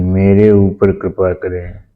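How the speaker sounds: ordinary talk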